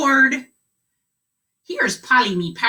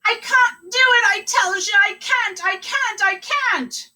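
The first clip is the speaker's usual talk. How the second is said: much higher, mock sobbing pirate; hammed-up pirate wailing; 14 semitones higher than usual. Pirate driver sobs after parrot flies out the window. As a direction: wail for the lost parrot.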